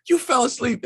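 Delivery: high, indignant you fell asleep; accusing. Retold or imitated. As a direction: nail her with the accusation. Accusing wife, story climax.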